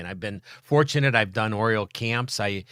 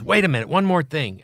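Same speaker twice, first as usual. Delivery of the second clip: imitating the manager; 6 semitones higher, 6 dB louder than usual. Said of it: "he goes".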